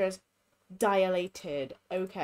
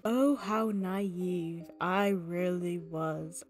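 dramatic narrator; 3 semitones lower, slower than usual. Pretend. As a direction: tell it like a movie trailer voice. Dramatic narrator aside with music.

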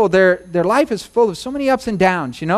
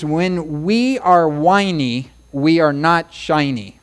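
slow, deliberate sing-song rhyme; 3 semitones lower, slower than usual. Shown as a demonstration. Preacher teaching a rhyme to remember, slowly. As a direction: draw each line out for memory. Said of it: "I like to rhyme things".

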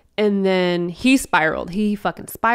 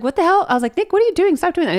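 high-pitched, alarmed telling-off of a brother; a hammed-up scold, half laughing at the brother; shocked scolding; 9 semitones higher, 3 dB louder, much faster than usual. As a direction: yelp it at him in disbelief. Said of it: "And I was like".

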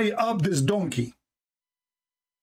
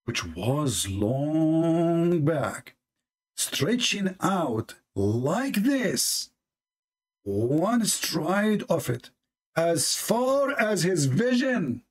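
drawn out; much slower than usual. Shown as a demonstration. Reading the hadith, stretching words.

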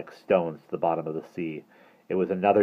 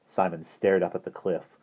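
ordinary delivery, nothing marked

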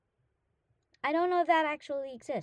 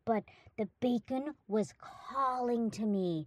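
normal talking voice

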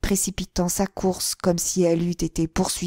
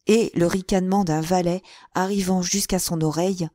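usual speaking voice

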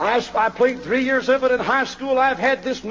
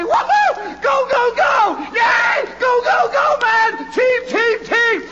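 cheering fan yell; 9 semitones higher, slower than usual. Retold or imitated. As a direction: shout like a fan watching his team score. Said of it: "this guy will stand up and say".

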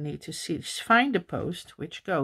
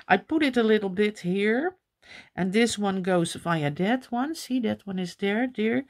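ordinary talk

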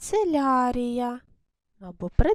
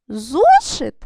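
Зошит as a loud query, pitch jumping on ЗО; loud, stressed ЗО in a questioning Зошит; questioning intonation; 8 dB louder than usual. Shown as a demonstration. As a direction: ask Зошит with a strong first syllable. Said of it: "використовувати саме питальну інтонацію".

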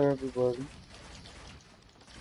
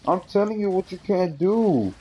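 exasperated; 6 semitones higher than usual. Real, not acted. Arguing with crying sibling.

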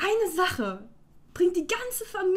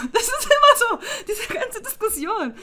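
high, laughing half-sob; laughing despair; 7 semitones higher, 5 dB louder than usual. Real, not acted. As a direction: laugh through the frustration. Laughing despair over argument.

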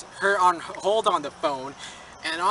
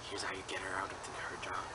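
hushed fast whisper; 12 semitones lower, 18 dB quieter, faster than usual. Retold or imitated. Student retells policeman's secret advice, fast whisper.

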